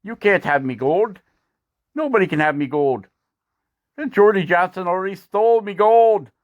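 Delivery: cackling leprechaun gloating over his gold; a cheeky leprechaun laughing at the carver; leprechaun taunt. Acted. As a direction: laugh and tease like a mischievous leprechaun. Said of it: "laughing at you, going".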